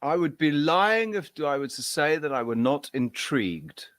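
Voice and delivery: slow, lightly posh English; a hint of a stiff upper-lip gentleman; mild posh British drawl. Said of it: "I thought that was how he did speak".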